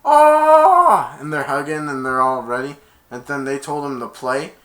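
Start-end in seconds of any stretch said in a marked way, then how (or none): 0.0-1.1 high, loud triumphant boast; gloating cheer. Retold or imitated. Player gloating over the other team, loud and high. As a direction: crow over the beaten team. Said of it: "he went".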